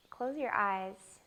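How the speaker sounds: unhurried meditation voice with long pauses; guided meditation. Real, not acted. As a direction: gently lead them into the breath, phrase by phrase. Leads a guided breath meditation.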